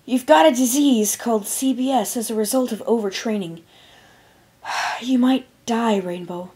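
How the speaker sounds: solemn diagnosis, slowing at the end; grim reply. A narrator's reading. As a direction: break bad news heavily. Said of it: "she responded grimly".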